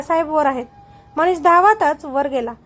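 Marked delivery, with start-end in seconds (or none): none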